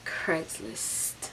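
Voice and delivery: whispered CRAIGSLIST; barely-there whispered aside; hushed echo. Real, not acted. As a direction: whisper it for dramatic effect.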